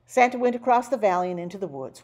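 usual speaking voice

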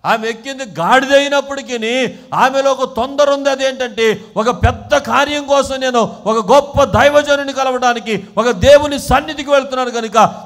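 loud, high, insistent preaching; preaching at full force. Real, not acted. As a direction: urge the congregation on forcefully.

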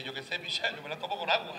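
low, soft deadpan doctor; doctor's voice. Retold or imitated. Doctor answers the patient, low deadpan reply. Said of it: "y dice".

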